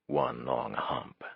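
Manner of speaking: low, slow, soft amused repeat; slow drunken murmur. A narrator's reading. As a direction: savour the phrase slowly, almost to yourself. Drunk mind repeats phrase.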